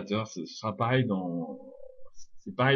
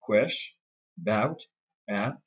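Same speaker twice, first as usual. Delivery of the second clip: "Quesh, about, a" each separately stressed; stressed syllables; much slower than usual. Shown as a demonstration. Teacher listing stressed syllables, "Quesh, about, a" each given full stress. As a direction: pick out QUESH, BOUT and A one by one. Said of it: "trois syllabes accentuées".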